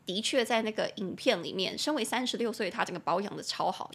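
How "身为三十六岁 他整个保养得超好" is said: ordinary talk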